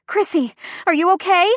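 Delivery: high, urgent question rising at the end; panicked scream. A narrator's reading. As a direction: call out anxiously, pitch jumping up. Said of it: "I scream".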